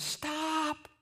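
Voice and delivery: high, drawn-out mock yell; strained high cry without full volume; yelling a warning. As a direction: call out high to halt someone. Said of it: "are yelling at times".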